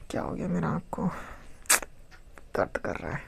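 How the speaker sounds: very soft, near-whispered mumble to oneself; barely voiced, under the breath; muttered aside. Real, not acted. Teacher to self about a hurting eye, muttered aside.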